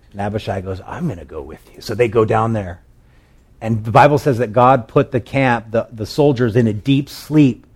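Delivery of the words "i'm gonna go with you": low, soft acted reply; hushed volunteer. Abishai volunteers to David at night, hushed and low. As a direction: say it quietly and low.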